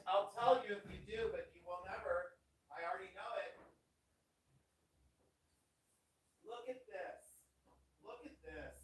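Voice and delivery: distant, off-mic voice; off-mic, far away. Real, not acted. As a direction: talk from across the room.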